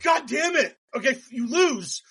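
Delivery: hammed-up frustrated ruling; exasperated judge. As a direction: scold them like a fed-up judge. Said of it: "the judge was like".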